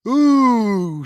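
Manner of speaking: long, loud, high ape "ooh"; drawn-out hammed-up ape call; big ape hoot. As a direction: hoot loudly like a cartoon gorilla. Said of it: "says Donkey Kong".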